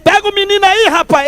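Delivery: high, urgent call across the church; pastor calling out. Retold or imitated. Pastor urges the lazy doorman to grab the boy, exasperated.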